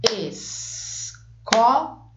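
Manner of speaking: Escola stretched very slowly, syllables pulled apart; slow syllable reading. Shown as a demonstration. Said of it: "Separe as sílabas de escola". (Escola muito devagar, separando as sílabas)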